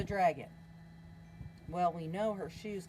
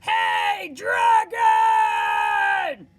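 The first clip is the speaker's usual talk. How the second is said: much louder, higher full shout; 13 semitones higher, 16 dB louder, much slower than usual. Acted. Princess shouting into the sleeping dragon's ear. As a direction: yell right into his ear. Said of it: "shouted as loud as she could".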